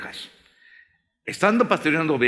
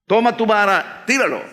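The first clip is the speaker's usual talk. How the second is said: commanding God; 6 semitones higher, 4 dB louder than usual. Acted.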